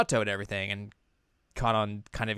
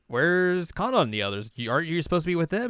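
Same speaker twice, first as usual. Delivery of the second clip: puzzled questioning; 8 semitones higher than usual. Retold or imitated. Ren questions Chisato.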